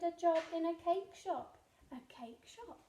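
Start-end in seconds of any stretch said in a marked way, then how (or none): 1.9-2.8 soft echoing whisper. Acted. Repeating the phrase to the listener, whispered. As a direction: breathe it like a fading echo.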